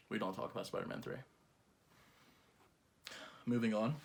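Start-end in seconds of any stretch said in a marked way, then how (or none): 0.1-1.2 even softer hushed warning; whisper-quiet, faster, like a secret; barely audible. Acted.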